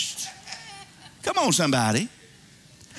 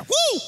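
high "woo" of admiration; delighted whoop; 11 semitones higher than usual. Acted. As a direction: let out a high, short whoop. Acting God delighted with his work.

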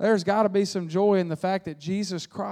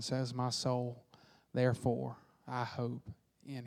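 slow, hushed, sinking lower; solemn scripture reading; 5 semitones lower, slower than usual. Acted. Reading Lamentations slowly.

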